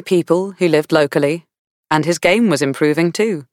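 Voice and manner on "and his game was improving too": plain reading voice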